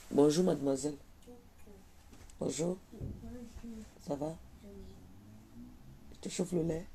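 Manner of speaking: put-on a hushed polite hello to the girl; quietly retold exchange; soft polite greeting. Quoted polite shopkeeper exchange.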